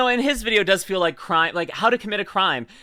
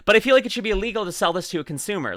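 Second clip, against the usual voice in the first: ordinary delivery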